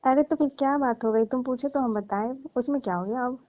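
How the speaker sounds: ordinary delivery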